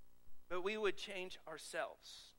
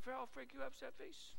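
put-on baby talk to dog; 5 semitones higher, 7 dB quieter than usual. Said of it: "we say".

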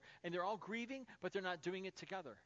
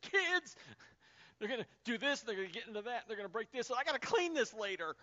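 much higher, louder panicked outburst; frantic worry; 5 semitones higher, 7 dB louder than usual. Retold or imitated. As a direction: fret wildly over the kids running around. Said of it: "losing my mind going".

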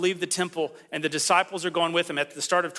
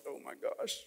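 breathless awed whisper; hushed mock shock from the disciples; stunned whisper; 11 dB quieter than usual.

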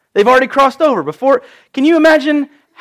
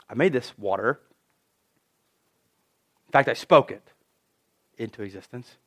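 low, hushed voice of God; calm creator, nearly fading away; quiet divine authority; 10 semitones lower, 11 dB quieter than usual. Acted. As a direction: say it softly, dropping very low.

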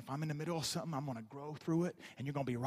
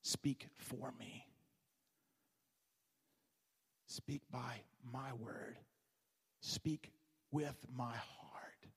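slow, soft, hushed divine voice; voice of God; 5 semitones lower, 11 dB quieter than usual. Acted. God calling Ezekiel to speak, hushed and slow. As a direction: let God speak low and slow, fading to a whisper. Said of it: "when God calls Ezekiel to speak, he says".

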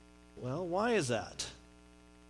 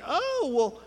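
high, slow, friendly drawn-out barista; chatty Texan barista; 8 semitones higher, slower than usual. Retold or imitated. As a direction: play the chatty Texas coffee server. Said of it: "they're like".

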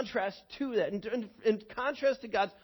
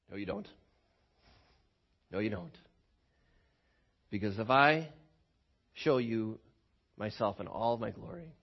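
solemn voice of God; 9 semitones lower, 3 dB quieter than usual. Acted. God gently refusing Moses, low and solemn. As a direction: speak low and grave as God.

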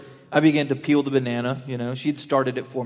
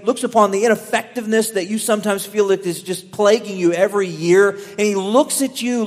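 rising, louder sermon build; impassioned preaching; 6 semitones higher, 4 dB louder than usual. Acted. Preacher building to the congregation, impassioned.

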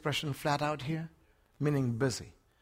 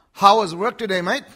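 asking an Aussie; 5 semitones higher, 12 dB louder than usual. Retold or imitated. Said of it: "when you ask an Aussie".

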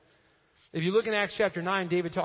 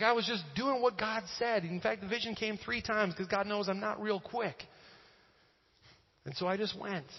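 lightly acted self-justification in higher pitch; Peter; 5 semitones higher than usual. Apostle saying he only obeyed God, mildly defensive. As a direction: excuse yourself, easing off at the end. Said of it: "he's defending himself".

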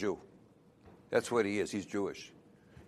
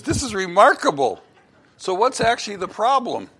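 amazed doctor; 8 semitones higher, 4 dB louder than usual. Acted. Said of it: "Doctor was amazed".

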